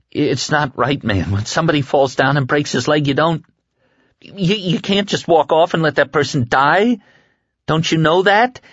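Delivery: put-on high, fast, pleading young man; pleading Larry. A narrator's reading. Larry begging Stu not to stay and die. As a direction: beg him to see sense.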